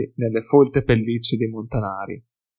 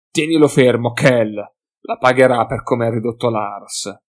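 louder, vengeful command; angry order; 5 dB louder than usual. Acted. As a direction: bark at Kell to hold him. Hef orders Kell, vengeful.

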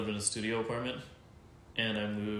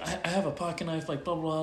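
imitated girl's voice, pitched up; threatening daughter; 6 semitones higher than usual. Daughter threatening him with a pocket knife, mock menacing. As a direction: put on a higher voice for her threat.

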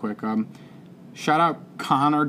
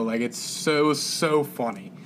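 ordinary talk

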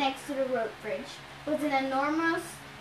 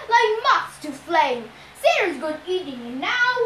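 loud, boastful monster voice, pitch often raised; proud Cyclops; 4 semitones higher, 6 dB louder than usual. A narrator's reading. Cyclops shows off his prized fleece to his captive, proud and loud. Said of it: "Polypema said proudly".

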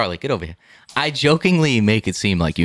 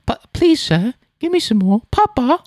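high, pleading British orphan voice; hammed-up waif begging meekly; Oliver. Son imitating the orphan from the play, pleading British waif. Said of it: "I'm thinking of Oliver".

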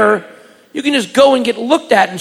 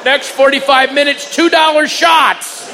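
announcing the deal, rising to a shout; mock club announcer yelling out cheap shots; 7 semitones higher than usual. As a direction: call out the drink special. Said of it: "and they said".

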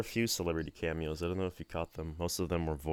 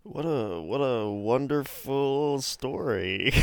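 sarcastic; 7 semitones higher, 5 dB louder, slower than usual. Acted. Sarcastic reply to boring story.

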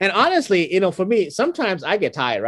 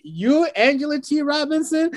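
put-on young guys calling out; 10 semitones higher than usual. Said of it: "they're like".

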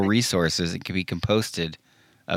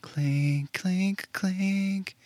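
high, soft squeaky voice; high squeaky clink; 11 semitones higher, 7 dB quieter, much slower than usual. Pretend. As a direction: squeak it like tiny glasses. Mock toast clinking sound.

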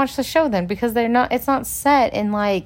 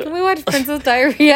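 put-on higher, louder little-kid question; lightly acted child, raised pitch; imitated young daughter; 5 semitones higher, 5 dB louder than usual. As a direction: ask it like an eager small child. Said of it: "She'd be like".

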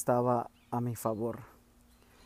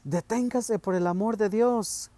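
much higher, loud pleading cry; 8 semitones higher, 4 dB louder than usual. A narrator's reading. Mother crying out to father to stop, loud plea. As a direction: beg him to stop, loud. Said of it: "clamó mamá".

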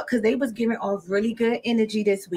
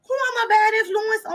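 high-pitched indignant retelling; mock-offended; 10 semitones higher than usual. Accused friend asking who she influences, mock-offended. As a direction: squeal it in mock outrage.

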